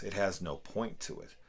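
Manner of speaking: usual speaking voice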